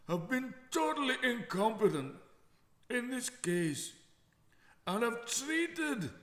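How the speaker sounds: a booming, theatrical Scottish brogue, drawn out word by word; Scottish accent. Acted. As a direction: read the councillor's quote in an over-the-top Scottish accent. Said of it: "And that was my Scottish accent".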